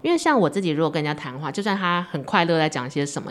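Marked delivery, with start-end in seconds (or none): none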